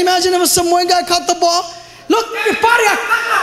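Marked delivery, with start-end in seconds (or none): 2.1-3.4 high, excited look-at-me voice; lightly voiced boasting fan; gloating fan. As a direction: show off the ball to the crowd. Guy showing off caught ball.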